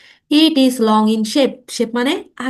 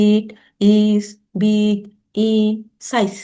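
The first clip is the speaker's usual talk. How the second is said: slow, word by word with long gaps; teacher modelling slow speech; slow practice pace; much slower than usual. Shown as a demonstration. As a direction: drill each word slowly for learners. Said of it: "slow বলবো".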